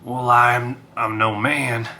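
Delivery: a cowboy Wolfkin, easygoing; country western. Acted. Wolfkin answers the wisp in a light country western accent. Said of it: "I start going, like, country western".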